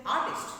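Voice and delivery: loud ARTIST, first syllable hit hard; model for repeating. Shown as a demonstration. Teacher showing what students may say, loud model word. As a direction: give ARTIST as an echo-me drill word. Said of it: "after me, artist".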